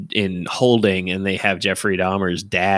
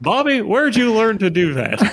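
high Texan twang, scolding; Bobby first, like Hank; Hank Hill impression; 12 semitones higher than usual. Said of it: "the word you gotta say to get into that impression".

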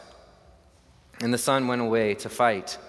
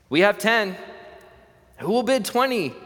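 much higher, louder auctioneer's call; 9 semitones higher, 3 dB louder than usual. Acted. Auctioneer calling for twenty, raised and high. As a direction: push the room for a bigger bid.

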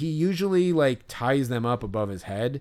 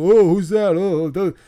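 higher, louder, hammed-up dopey fan voice; dumb idiot; 6 semitones higher, 6 dB louder than usual. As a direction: play a clueless fan shouting guesses. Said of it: "if I was some dumb idiot who was like".

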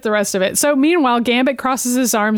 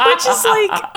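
laughing; 11 semitones higher, slower than usual. Real, not acted. Laughing at scene.

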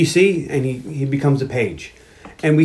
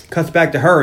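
normal talking voice

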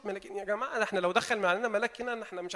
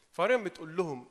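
normal talking voice

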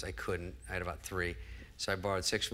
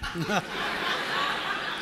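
high breathless laugh; high unvoiced laugh; 8 semitones higher than usual. Real, not acted. Guest laughing at his own concussion joke. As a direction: cracks himself up.

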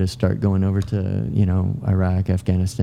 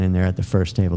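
ordinary delivery

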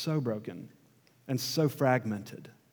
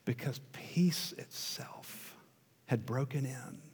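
hushed whisper; 9 dB quieter than usual. Real, not acted. Nativity telling, hushed awe.

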